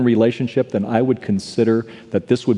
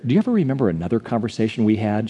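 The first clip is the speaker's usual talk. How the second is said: ordinary talk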